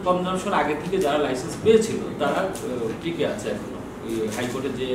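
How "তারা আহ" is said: ordinary talk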